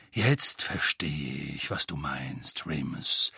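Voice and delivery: very low, slow and soft wizard; deep, deliberate voice. A narrator's reading. Bald wizard at the back to Remus, deep and deliberate. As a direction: speak low and measured, taking your time. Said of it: "Er hatte eine tiefe, bedächtige Stimme".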